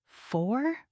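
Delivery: soft, breathless rising "Four?"; stunned quiet question. A narrator's reading. She answers the alpha in a hushed, stunned question. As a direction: barely voice it in disbelief. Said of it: "I whisper".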